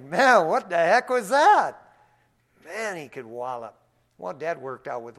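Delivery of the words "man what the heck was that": high, loud stunned outburst; like just waking from a blow; dazed surprise. Retold or imitated.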